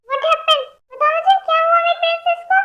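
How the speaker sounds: anxious high voice. Acted. Worried family member at bedside.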